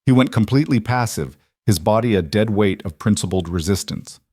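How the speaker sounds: usual speaking voice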